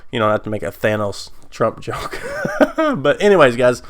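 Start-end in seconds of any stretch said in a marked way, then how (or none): 2.2-2.9 sudden high giggle; high burst of laughter. Real, not acted. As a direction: laugh at your own joke. Laughing at own Thanos joke.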